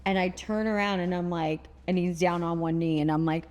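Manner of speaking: ordinary talk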